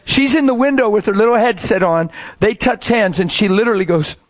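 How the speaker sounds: ordinary delivery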